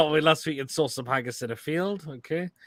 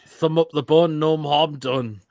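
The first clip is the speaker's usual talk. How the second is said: ordinary talk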